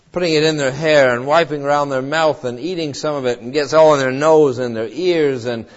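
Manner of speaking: ordinary delivery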